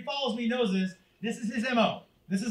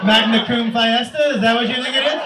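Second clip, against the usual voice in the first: sarcastic mock; 8 dB louder than usual. Dad mocking son's story.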